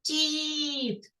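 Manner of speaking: "кіт" with a long і; drawn-out second sound. Shown as a demonstration. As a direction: stretch the і in "кіт". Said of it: "Другий звук".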